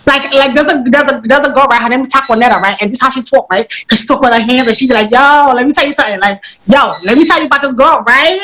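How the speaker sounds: put-on American girl accent, sassy and casual; Takonetta. Shown as a demonstration. Actor demonstrating her US character for the host, American accent. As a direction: talk like a girl from the States. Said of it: "you're back in the States".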